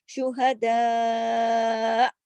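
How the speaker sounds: शुहदा with long दा and a catch at the end; slow recited शुहदा; drawn with glottal stop. Shown as a demonstration. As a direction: stretch शुहदा then stop in the throat. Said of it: "खींचा, इसलिए कि मद है".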